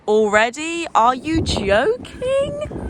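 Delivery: high, slow amazed "are you joking"; stunned by the view, drawn out; amazed disbelief. Real, not acted.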